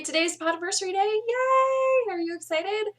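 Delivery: fast, high, loud cheer; bubbly anniversary cheer to the viewers; excited celebration. Real, not acted. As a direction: throw a party in your voice.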